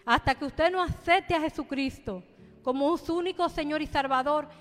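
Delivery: fervent pulpit exhortation; preaching. Acted. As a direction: call them to come to the Lord. Preaches to the congregation.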